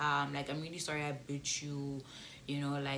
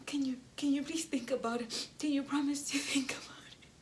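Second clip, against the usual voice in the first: gentle plea; 10 semitones higher than usual. Retold or imitated. Begging him softly.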